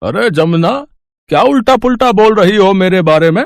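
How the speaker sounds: high, loud outburst at his wife; loud scolding husband. Acted. Father bursting in on wife talking about him. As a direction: cut in sharply, half scolding.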